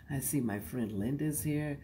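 ordinary talk, nothing marked

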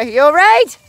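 loud high concerned call. Real, not acted. Mom checking on a child sprayed in the eye, loud call. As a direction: shout the check-in across.

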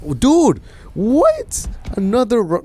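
high, loud burst of disbelief; loud high-pitched what; shocked yell. Real, not acted. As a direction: yell it like you can't believe it. Another bad pack appears.

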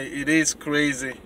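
normal talking voice

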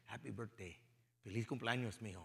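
soft, low Spanish-accented imitation of dad; father's Spanish accent. Father greeting his son on his birthday, gentle Spanish accent. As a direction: quietly mimic a Spanish-speaking father. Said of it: "in his accent".

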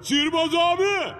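yelling across the room. Acted. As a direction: shout to be heard far away. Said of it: "Bağırmasana çocuğum".

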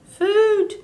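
tiny, high-pitched chick voice; peeping gosling. A narrator's reading. Hungry gosling demanding to be fed, high peep. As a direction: peep it high like a baby bird. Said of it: "peeped the gosling".

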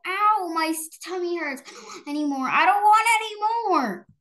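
put-on high, loud childish wails of pain; crying out in pain. Girl clutches her belly and wails high about her tummy. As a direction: wail like a kid with a tummy ache. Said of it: "Nora grabbed her belly and cried".

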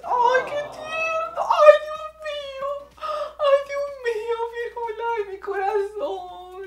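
high, slow, gushing coo. Real, not acted. Gushing to the dog Firulais, high slow baby-talk coo. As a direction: melt over a cute puppy.

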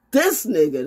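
normal talking voice

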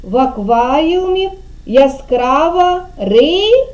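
slow "В акваріумі яскрава", hanging on rising "ри-"; leaving word unfinished. Shown as a demonstration. Teacher cueing the pupil to finish, slow with suspended "ри-". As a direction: draw out the sentence and stop on "ри-". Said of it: "а ви будете закінчувати".

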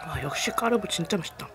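talking while chewing; muffled through food; mouth full of food. Real, not acted. Seen 0: Ate at -12; cheeks puffed, lips closed, chewing motion while speaking.

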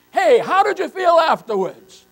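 loud, high call to the crowd. Real, not acted. Preacher calling out to the congregation, loud and high. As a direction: throw the question out to the whole room.

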